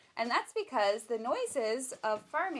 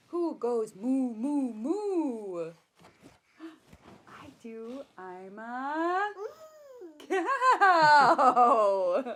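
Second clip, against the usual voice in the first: put-on reading to a baby; 3 semitones higher, 10 dB louder, much slower than usual. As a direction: draw the baby in with each animal. Reading picture book to baby.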